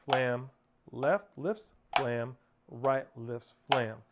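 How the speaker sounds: slow rhythmic calling of strokes; unhurried, steady drum count; nice and slow. Shown as a demonstration. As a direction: leave space after each word, in time. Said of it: "Nice and slow".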